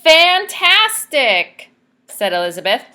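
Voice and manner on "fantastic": high, loud and slow, drawn out; amazed praise. Acted. Princess flatters the dragon, gushing and drawn out. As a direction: gush it, stretched out.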